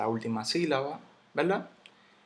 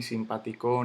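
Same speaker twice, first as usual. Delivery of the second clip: simpático stressed on CO, simpati-CÓ; wrong final stress. Shown as a demonstration. Teacher rejecting a wrong version, simpático stressed on final CO. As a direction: land on the end of simpático. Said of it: "Yo no digo simpático".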